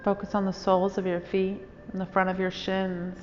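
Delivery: calm, even meditation voice with long pauses; guided meditation. Real, not acted. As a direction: guide listeners unhurriedly into stillness, phrase by phrase.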